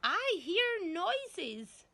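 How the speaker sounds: put-on high, loud, slow old-woman screech; shrill accusing grandma voice; screeching granny. A narrator's reading. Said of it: "Granny screeched".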